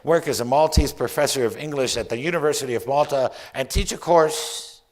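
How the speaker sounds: loud, emphatic poetry reading; poem recital. Acted. Poet dreaming up a whole new life in Malta, theatrical poem recital. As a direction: build each what-if into a rising, projected daydream. Reads own poem aloud.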